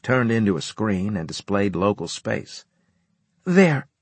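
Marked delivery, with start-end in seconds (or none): 3.4-3.9 emphatic. A narrator's reading. Pointing at screen forcefully.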